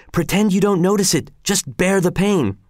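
ordinary delivery, nothing marked

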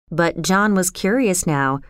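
ordinary delivery

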